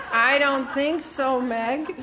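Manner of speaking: amused, emphatic refusal; firm refusal. Retold or imitated. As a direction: turn her down with a laugh. Refusing friend, laughing.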